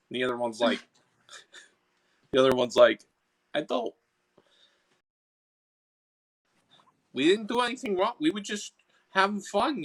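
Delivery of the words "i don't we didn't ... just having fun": high, stubborn Boston sprite; defensive Boston accent, pitched up. Acted. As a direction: protest innocence in a high Boston voice. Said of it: "I'm stuck in the Boston accent".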